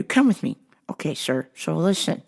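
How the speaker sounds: normal talking voice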